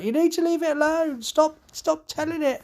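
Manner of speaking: very high shrill panicked voice; hysterical plea. Retold or imitated. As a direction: shriek at him to stop provoking it. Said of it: "She's like".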